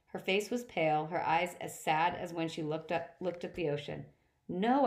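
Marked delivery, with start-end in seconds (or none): 4.5-4.9 high, loud no; a boy shouting no at his mother. A narrator's reading. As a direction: shout it in refusal. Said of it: "I shouted".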